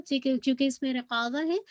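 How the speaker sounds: normal talking voice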